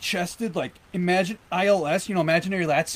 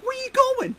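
"Where you going?" squeaked much higher; stronger mimic of Baker. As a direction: push the impression further up. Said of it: "trying to mimic his".

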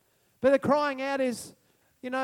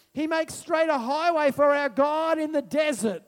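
raised, driving sermon voice; impassioned preaching; 5 semitones higher, 3 dB louder than usual. Real, not acted. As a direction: proclaim scripture to the congregation. Preaches from scripture at raised pitch.

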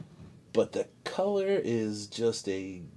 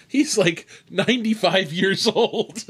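higher voice breaking into laughter; real laughing; 7 semitones higher, slower than usual. Real, not acted. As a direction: barely get the punchline out through laughter. Cracks up at own punchline.

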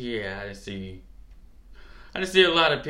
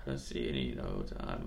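hushed low aside; 12 semitones lower, 10 dB quieter than usual. Retold or imitated. Retold quote, low and hushed.

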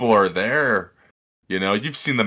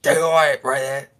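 strained, hammed-up Australian accent; exaggerated Australian twang; 3 semitones higher than usual. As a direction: do an over-the-top Aussie voice. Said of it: "the shitty ass Australian face".

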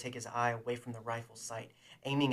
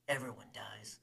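grim whisper; 2 semitones lower, 3 dB quieter than usual. Acted. Bounty hunter answering what they will do, grim whisper. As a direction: breathe it out grimly.

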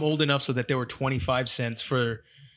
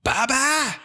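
put-on high, loud toddler yell; toddler shout; 12 semitones higher, 4 dB louder than usual. The baby yelling at the timeout door, high toddler shout. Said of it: "he goes".

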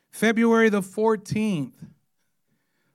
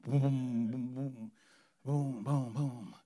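car bass thump; 6 semitones lower, 9 dB quieter, slower than usual. Retold or imitated.

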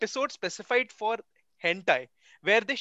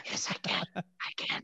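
very soft, hushed mock ASMR replies; mock ASMR murmur; ASMR whisper; 11 dB quieter than usual. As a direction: keep whispering, barely audible. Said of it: "that's something we call A S M R".

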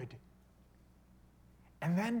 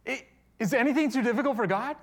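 loud, high challenge as God; raised, ringing rhetorical question; acted rebuke; 9 semitones higher, 11 dB louder than usual. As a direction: play God calling someone on the carpet. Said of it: "calls Sarah on the carpet".

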